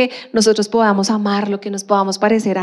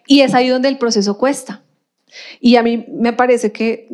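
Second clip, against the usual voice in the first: ordinary talk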